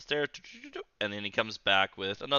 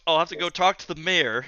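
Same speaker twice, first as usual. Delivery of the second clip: a little higher and louder, playing the innkeeper; NPC innkeeper voice; 5 semitones higher, 7 dB louder than usual. Innkeeper bluffing to the party, in a lightly raised voice. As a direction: put on the barkeep's voice, a touch brighter.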